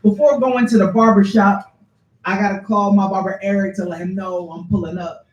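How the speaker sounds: high, loud, punchy poem opening; spoken-word poem. Acted. Poet tells audience of calling the barber, projected spoken word. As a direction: launch into the poem, projecting to the room. Performs next poem aloud.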